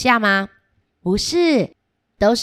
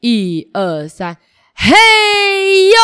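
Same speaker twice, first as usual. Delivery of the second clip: loud heave; 11 semitones higher, 7 dB louder than usual. Shown as a demonstration. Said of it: "好，数到三哦".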